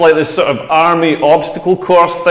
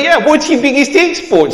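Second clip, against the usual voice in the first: higher-pitched comic Australian; Australian accent; 6 semitones higher than usual. Acted. Australian flatmate repeating his question about exports, mock Australian accent. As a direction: repeat it in a mock Aussie twang. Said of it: "that's exactly what Australians sound like".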